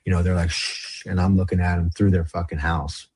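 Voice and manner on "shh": hushed, voiceless "shh"; imitated shush. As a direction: mimic someone quietly shushing. Friends shushing while lying on phone.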